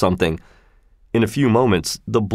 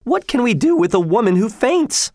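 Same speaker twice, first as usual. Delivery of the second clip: much higher, exasperated lady's question; exasperated cry; 12 semitones higher than usual. A narrator's reading. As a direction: throw up her hands in mock despair. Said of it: "cried Missus Otis".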